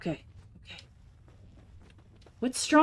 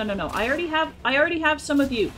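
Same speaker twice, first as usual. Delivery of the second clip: usual speaking voice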